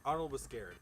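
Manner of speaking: normal talking voice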